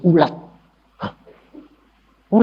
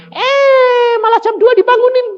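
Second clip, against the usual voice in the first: loud complaint; 5 dB louder than usual. Retold or imitated. Complaining about being woken.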